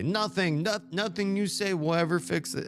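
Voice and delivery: high, pained character voice; a voiced quote from the heroine; voicing her. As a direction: speak her line up high and hurt. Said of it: "she's got the right to say".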